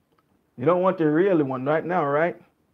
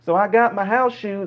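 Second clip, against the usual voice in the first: higher-pitched rambling Southern storyteller; slow-to-the-point Southern dialect; 4 semitones higher than usual. Acted. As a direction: ramble sociably in a Southern accent, in no hurry. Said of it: "the other dialect of Southern people down there".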